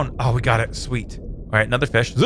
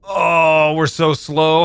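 ordinary delivery

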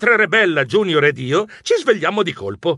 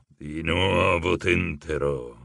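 deep, slow, hypnotic chant; powerful hypnotic voice; 8 semitones lower, slower than usual. A narrator's reading. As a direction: cast a spell with the voice. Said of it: "com'era potente la sua voce". (il mago ipnotizza i protagonisti, voce bassa e lenta)

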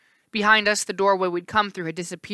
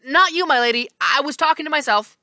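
nervous; 8 semitones higher, 5 dB louder than usual. Acted. Janus apologizes nervously.

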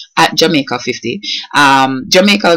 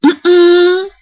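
high, drawn-out dismayed hum; dismayed reaction; 12 semitones higher, 3 dB louder, much slower than usual. Shown as a demonstration. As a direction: react to bad news, high and stretched. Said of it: "like something bad happen, and the person go".

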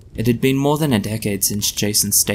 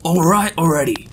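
much higher, louder impatient roar; gruff roar; 8 semitones higher, 4 dB louder than usual. A narrator's reading. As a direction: roar back through the door. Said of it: "roared a gruff voice".

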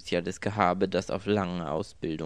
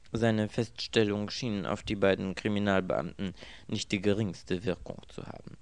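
ordinary delivery, nothing marked

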